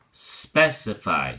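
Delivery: specify said slowly with the stress on SPEC, SPEC-i-fy; stress on first syllable. Shown as a demonstration. Teacher showing the stress shift to the class, slow. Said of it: "the accent changes to the first syllable".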